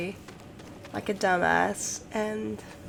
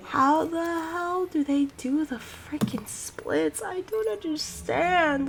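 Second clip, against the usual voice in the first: exasperated; 7 semitones higher, slower than usual. Retold or imitated. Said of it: "I'm just like".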